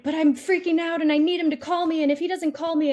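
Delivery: high, fast, panicky worry; anxious freak-out. Retold or imitated. Client frets to her coach that he must call, high and fast. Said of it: "she's like".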